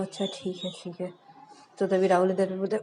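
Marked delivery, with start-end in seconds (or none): 0.0-1.1 soft, dropping to a hush; hushed aside. Acted. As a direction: keep it down so the caller can't hear. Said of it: "श्रुति इशारों में बोलती". (धीमी फुसफुसाती आवाज़)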